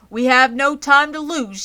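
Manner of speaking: loud, high urgent shout. A narrator's reading. Zuckerman shouts to hurry to the judges. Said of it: "shouted Mister Zuckerman".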